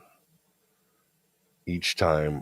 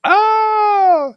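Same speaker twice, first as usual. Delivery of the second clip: a sudden high, loud mock scream of horror; horrified scream; 12 dB louder than usual. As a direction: shriek "Ah!" in hammed-up horror. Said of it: "horrified that I was like".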